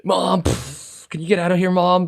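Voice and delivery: high, mock-annoyed kid whining at mom; angry kid. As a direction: complain up at mom like a peeved kid. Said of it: "an angry kid yelling at his mom".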